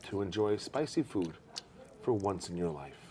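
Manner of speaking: soft, low mock-solemn hush; hushed coaxing. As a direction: talk them gently through it, dropping to a whisper. Coaxes guest through spicy tasting.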